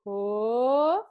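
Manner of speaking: loud drawn-out oh; exaggerated oh. Shown as a demonstration. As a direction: belt out a big oh. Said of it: "and then we're gonna go".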